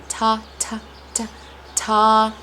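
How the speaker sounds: "ta" with a crisp t; isolated "ta" syllables with a real T; true T sound. Shown as a demonstration. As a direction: repeat "ta" in separate bursts, T clearly sounded. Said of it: "does sound like a T".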